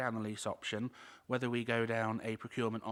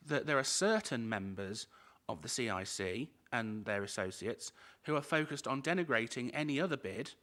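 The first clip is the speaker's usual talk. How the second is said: normal talking voice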